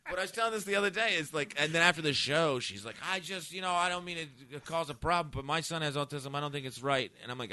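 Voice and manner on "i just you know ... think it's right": high-pitched imitation of an apologetic objector; complaining audience member. Offended mother approaching comic after the show, high mimicked complaint. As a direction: lightly mimic a hesitant complainer.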